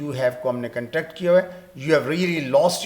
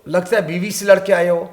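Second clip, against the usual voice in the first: loud, teasing jab at a grumpy man; mocking taunt; 2 semitones higher, 6 dB louder than usual. Teacher acts out teasing a man who fought with his wife. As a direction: throw it at him like a taunt. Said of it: "بندہ بیوی سے لڑ گیا تو غصہ".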